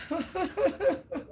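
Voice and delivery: high, soft string of giggles; amused chuckle. Real, not acted. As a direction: giggle quietly at your own singing. Laughs after singing the carol.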